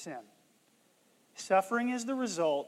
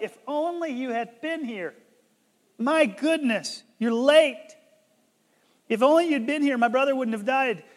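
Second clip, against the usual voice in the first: raised, higher exasperated complaint; louder, pitched-up grieving reproach; acted reproach; 6 semitones higher, 7 dB louder than usual. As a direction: scold someone who came too late. Acting the sisters' reproach to Jesus.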